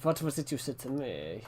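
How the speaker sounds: usual speaking voice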